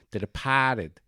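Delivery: Boston-accented "Departed", dropping the r. Shown as a demonstration. Host mimics the movie's Boston pronunciation, Boston accent. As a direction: say "Departed" as broad Boston, "De-pah-ted". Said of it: "'cause they're all from Boston".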